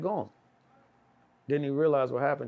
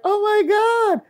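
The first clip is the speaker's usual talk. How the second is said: shocked gasp; 13 dB louder, much slower than usual. Retold or imitated. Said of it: "she's like".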